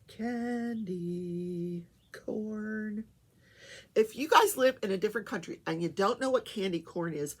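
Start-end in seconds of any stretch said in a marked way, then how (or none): 0.1-3.0 drawn out. Real, not acted. Savoring the name.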